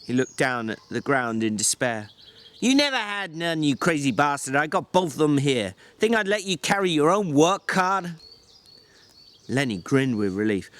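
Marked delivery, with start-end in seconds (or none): 2.6-8.1 a weary ranch hand berating his companion; George, exasperated. Acted. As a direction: snap at him like a fed-up older brother. George scolds Lenny.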